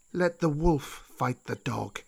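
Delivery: slow, drawn-out serpent voice; old snake Kaa. Acted. Old snake reminds Mowgli of the pack, slow and drawn-out. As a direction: caution slowly as an old serpent. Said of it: "Kaa said".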